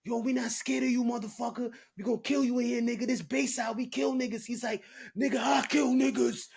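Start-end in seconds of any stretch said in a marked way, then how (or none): 0.0-4.4 mock yelling match. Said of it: "it's just a yelling match". 5.2-6.5 high shouted threat in a yelling match; retold shout. Big inmate shouting back at rival inmates, high mock shout. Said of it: "it's just a yelling match".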